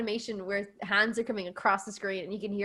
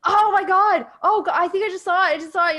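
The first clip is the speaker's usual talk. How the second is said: louder, high mock alarm; excited alarm; 8 semitones higher, 8 dB louder than usual. Imitating Todd announcing the ghost, loud and high. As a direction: blurt it like you just saw the ghost. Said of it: "And Todd's like".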